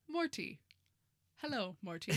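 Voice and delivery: imitating a stiff robot; mock robot greeting. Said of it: "You sound like a robot when you say it".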